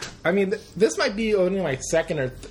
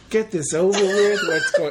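mock impatience; 4 semitones higher, 5 dB louder than usual. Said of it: "other times you're like".

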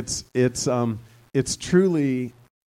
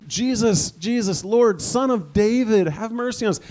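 high, loud desperate cry; 9 semitones higher, 4 dB louder than usual. Acted. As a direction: beg him to stop and hear you. Blind men crying out.

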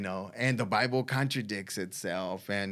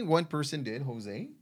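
usual speaking voice

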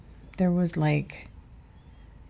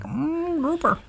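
high, drawn-out "Mm, grouper"; mock savoring; 10 semitones higher, slower than usual. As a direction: savor it like a foodie. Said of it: "They're like".

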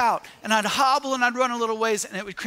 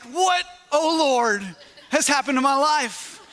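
amused disbelief; 4 semitones higher than usual. Retold or imitated. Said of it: "I'm like".

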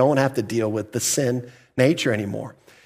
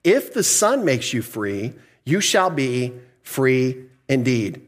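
slow scripture reading; slower than usual. Shown as a demonstration. Preacher reading Jesus' words to the church slowly. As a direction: read out slowly, weighing each word. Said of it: "he's gonna say here".

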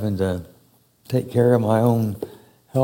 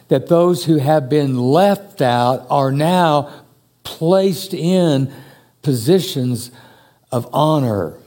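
lifted, slowed preaching cadence, higher and louder; 4 semitones higher, 5 dB louder than usual. Acted. Preacher lifting the congregation toward honor, preaching. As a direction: preach it out, building each phrase. Rising sermon climax.

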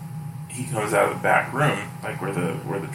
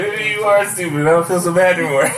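higher and louder, laughing through it; laughing teasing; 9 semitones higher, 6 dB louder than usual. Real, not acted. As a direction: tease him while cracking up.

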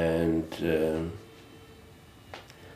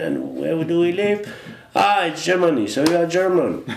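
raised, insistent telling-off voice; mock lecture to son; 7 semitones higher than usual. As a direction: lay down the law to him, half joking. Mock lecturing his son on identity.

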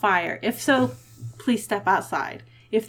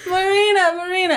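high calling voice, beckoning; 9 semitones higher, 3 dB louder than usual. Acted. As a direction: call her name across the room. Calling out for servant.

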